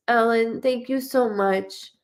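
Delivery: normal talking voice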